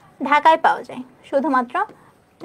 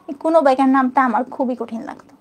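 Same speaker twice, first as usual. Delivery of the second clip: ordinary talk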